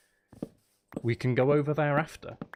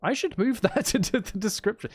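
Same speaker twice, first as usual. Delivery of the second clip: laughing; 9 semitones higher, 3 dB louder than usual. Real, not acted. Laughing at own omission.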